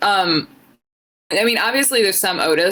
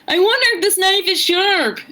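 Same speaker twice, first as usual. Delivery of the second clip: high dopey voice; 12 semitones higher than usual. Retold or imitated. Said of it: "I was like".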